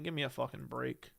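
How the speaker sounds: low, soft dismissive aside; quiet exasperation. Real, not acted. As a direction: say it under your breath, fed up. Muttered dismissal, then repeated emphatically.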